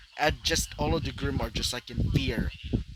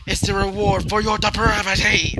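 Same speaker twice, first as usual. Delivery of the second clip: loud, higher, menacing villain shout; 6 semitones higher, 7 dB louder than usual. Acted. Vlad condemning the Grimm loudly. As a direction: condemn them at full voice. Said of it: "as he would continue".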